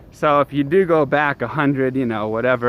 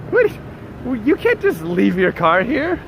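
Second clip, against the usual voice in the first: higher, baffled retold protest; mock disbelief; 6 semitones higher than usual. Retelling reaction to driver parking on sidewalk, mock disbelief. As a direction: protest as if you can't believe it. Acting out disbelief at parked car.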